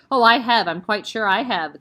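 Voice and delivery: loud, gloating with a grin; grinning. Acted. Headmistress gloats to Miss Honey, grinning. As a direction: relish the cruelty. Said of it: "the Trunchbull answered, grinning".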